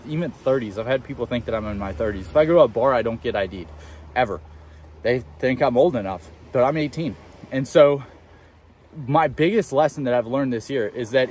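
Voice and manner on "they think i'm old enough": usual speaking voice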